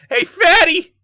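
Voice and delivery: high, loud jeering cop voice, sneering at a suspect; mocking cop. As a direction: cop sneering at the suspect over his chicken receipt, jeering loudly. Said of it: "being like".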